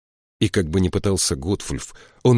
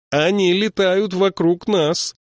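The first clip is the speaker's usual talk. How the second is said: high, slow raised voice; almost shouting; 12 semitones higher, 4 dB louder, slower than usual. A narrator's reading. Troll over cawing ravens, almost shouting. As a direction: push the voice up high over a din. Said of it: "пришлось почти кричать".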